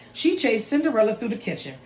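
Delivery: plain reading voice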